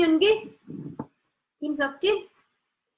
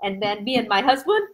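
usual speaking voice

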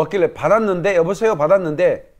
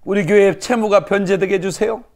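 retold tearful prayer; 3 semitones higher than usual. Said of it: "그렇게 기도했어요".

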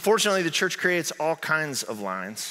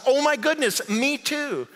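high, louder, hammed-up thrill; delighted amazement; 11 semitones higher, 4 dB louder than usual. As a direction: act overjoyed at the match. Joke man delighted, escalating.

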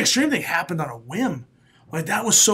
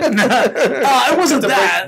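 laughing; 6 semitones higher, 5 dB louder, slower than usual. Real, not acted. Laughing through repeated denial.

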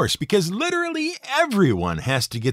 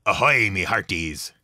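put-on West Country pirate accent; 6 semitones lower than usual. Narrator imitating screen pirates, in a low West Country accent. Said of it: "is the West Country accent brought to the screen".

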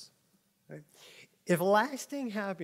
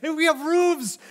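higher and louder, laughing over-the-top forecast; mock weatherman; 4 semitones higher, 11 dB louder than usual. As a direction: sell the weather like breaking news. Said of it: "because the weatherman's".

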